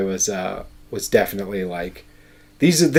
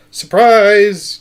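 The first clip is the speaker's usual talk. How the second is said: loud, higher sudden "Surprise!"; jump-scare shout; 11 semitones higher, 10 dB louder than usual. Pretend. Ambusher springing out of the inn, mock shout. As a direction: leap out yelling it. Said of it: "jump out and go".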